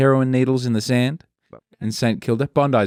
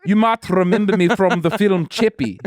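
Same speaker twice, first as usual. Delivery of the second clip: [name] impression; 6 semitones higher than usual. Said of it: "I'm gonna do my Ginger from the Antwoord".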